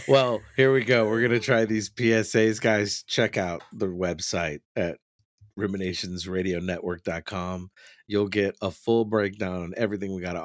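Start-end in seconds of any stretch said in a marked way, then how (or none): none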